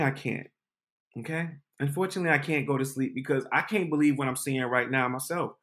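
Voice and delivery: ordinary delivery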